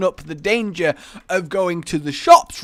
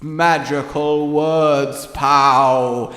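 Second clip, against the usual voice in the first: intoning voice; 6 dB louder, much slower than usual. Shown as a demonstration. Showing how a spell should be said, intoning voice. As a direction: intone it slowly like casting a spell. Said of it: "do it in the intoning voice".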